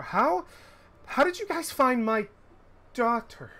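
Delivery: high, astonished question; father asking the party how they found his daughter, high and amazed. Acted. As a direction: ask it high in wonder.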